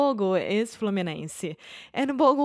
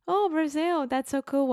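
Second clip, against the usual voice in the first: high, mildly excited retold exclamation; lifted, friendly mock enthusiasm; delighted reaction; 8 semitones higher than usual. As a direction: imitate someone pleased to hear where you're from. Acting out the waiter's delighted reaction.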